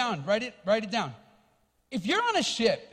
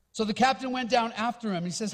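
ordinary delivery

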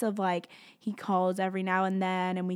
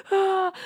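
sharp gasping sob, then a high loud "Ah"; sobbing gasp; 12 semitones higher, 6 dB louder than usual. Retold or imitated. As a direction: catch your breath like crying too hard to breathe. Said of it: "you know that cry that you're like".

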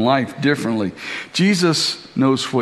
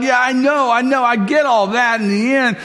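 dismissive; 8 semitones higher than usual. Retold or imitated. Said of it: "And she's like".

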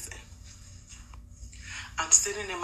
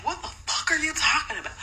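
husband's mock retort; 10 semitones higher, faster than usual. Husband voiced in retold quarrel.

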